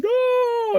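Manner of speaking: very high falsetto wail of protest; hammed-up anguished cry; squeaky mock despair; mock crying physicist. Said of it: "a physicist out there going".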